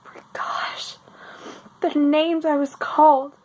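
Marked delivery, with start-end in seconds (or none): 0.0-1.0 whispered through tears; barely voiced, fighting tears; tearful whisper. Real, not acted. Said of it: "I didn't think I was gonna cry".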